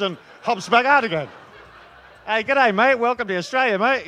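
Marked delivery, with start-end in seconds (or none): 2.2-4.1 loud, higher-pitched Aussie welcome; hammed-up, matey Australian hello; cheery Aussie greeter. Aussie officer greeting a traveller at customs, loud and matey.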